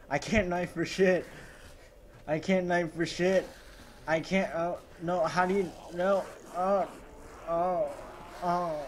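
losing it as zombies break in; panicked yelling. Real, not acted.

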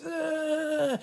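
high-pitched mock scream; drawn-out pretend panic cry; running-away scream. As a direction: scream as if fleeing into the woods. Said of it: "he takes off running into the woods".

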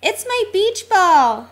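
high, loud cry of discovery; excited exclamation. A narrator's reading. T-Bone spots his lost ball.

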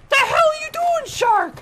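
high shouted outrage at the shark; outraged yell. Real, not acted. Yelling at the shark in game.

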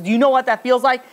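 mild imitation of crying out in grief; retold tearful outburst. Grieving mother to the old woman who approached her, retold tearful outburst. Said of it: "she starts crying to her".

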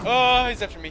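high, loud panicked cry; being chased. Real, not acted. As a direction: yelp it while fleeing. Monster chasing him.

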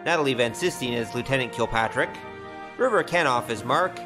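ordinary talk, nothing marked

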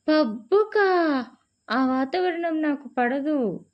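high, loud, slow shocked question. A narrator's reading. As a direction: recoil at the very idea of a pub. Shocked man hears about pub. (ఆశ్చర్యంతో గట్టిగా, నెమ్మదిగా)

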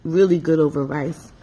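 talking between bites; chewing while talking. Real, not acted. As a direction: mouth partly full. Seen 0: tastes food at -7, mouth full/chewing until about 3.7.

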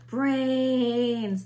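loud, groaning zombie horde; hungry horde moaning. A narrator's reading. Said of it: "they moaned".

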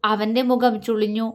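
ordinary delivery, nothing marked

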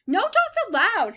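high, forceful storybook yell. A narrator's reading. Hank yells at a passing coyote, high and loud. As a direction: yell a ban at full voice. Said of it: "Hank yelled".

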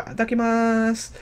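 put-on high, slow cute anime girl voice; imitating Madeira. Reacting to the anime, copying how Madeira talks. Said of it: "I like how Madeira speaks".

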